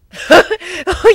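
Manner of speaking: very high, loud giggling burst; high burst of laughter. Acted. Ponies bursting into laughter, high loud giggle. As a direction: crack up at the silly claim.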